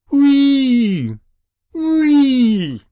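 long high siren "whee"; screaming like an engine. A narrator's reading. Boy on the roof flapping his arms, screaming like an engine. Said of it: "He began screaming like some kind of engine".

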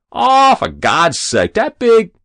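loud, high, exasperated outburst; exclaimed blasphemy. A narrator's reading. Hero scoffing at a rival's name, exclaiming. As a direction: protest loudly, voice climbing until cut off. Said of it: "shut off his blasphemies".

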